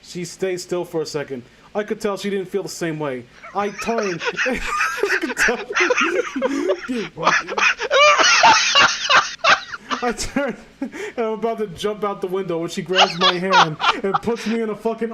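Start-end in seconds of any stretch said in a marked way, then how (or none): 4.3-10.0 shrieking laugh. Real, not acted. Long burst of laughter.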